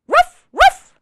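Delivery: high, loud double woof; puppy woof. Acted. Biscuit answering the reader, high loud puppy woofs. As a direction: yip like an eager little puppy. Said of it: "say it with me".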